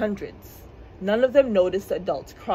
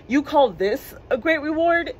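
higher-pitched, mildly indignant question; incredulous scoff; 6 semitones higher, 4 dB louder than usual. A narrator's reading. Piper challenges the mayor's reward, incredulous scoff. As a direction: question it in disbelief, pitch lifted.

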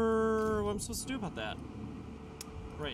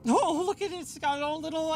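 surprised; 8 semitones higher, 4 dB louder than usual. Real, not acted. Amused at enemy icon.

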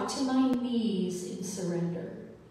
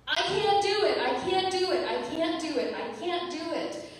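reliving ugly crying; 8 semitones higher than usual. Retold or imitated. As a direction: cry it out as if back in that moment, then let it die down. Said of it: "I was ugly crying then".

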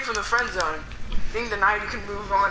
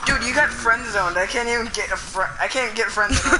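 ordinary delivery

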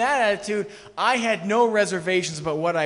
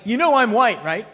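louder, higher, with a blunt deadpan; mock obvious statement; 4 semitones higher, 10 dB louder than usual. Preacher mocking a man stating the obvious, louder and higher. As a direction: announce the obvious as if it were news.

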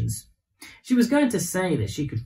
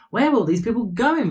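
put-on curious child voice; 7 semitones higher, 4 dB louder than usual. A narrator's reading. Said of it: "Vera asked".

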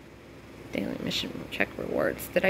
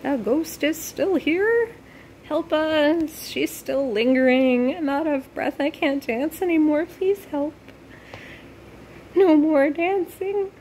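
high-pitched, hammed-up plea; playfully acted distress, pitched well up; pleading character voice; 9 semitones higher than usual. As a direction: plead for help in a high mock-frightened voice. Voicing game characters' pleas for help.

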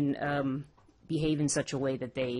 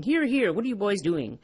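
mild scolding, starting high and raised; lightly voiced reprimand to children; scold, easing off after the opening; 5 semitones higher, 5 dB louder than usual. A narrator's reading. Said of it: "He scolded the boys".

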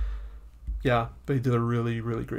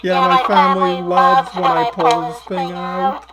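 very high, louder pitched-up elf voice; elf voice changer; 11 semitones higher, 8 dB louder than usual. Pretend. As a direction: keep talking through the elf voice changer. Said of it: "it's my elf voice changer".

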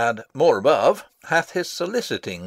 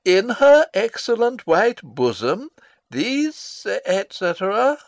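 higher, mock-lovesick letter reading; stage direction: father reads a prince's love letter aloud, higher and mock-tender; 7 semitones higher than usual. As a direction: read out the young lover's gushing words, a touch mocking.

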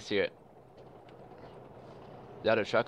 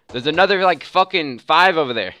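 louder, higher, worked up; excited; 6 semitones higher, 9 dB louder than usual. Real, not acted. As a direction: blurt it out, amazed. Spotting many enemy snipers.

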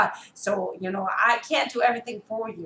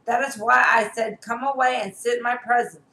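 ordinary talk, nothing marked

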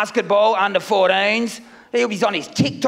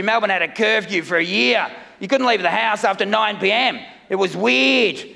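ordinary talk

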